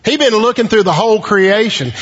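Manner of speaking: ordinary talk, nothing marked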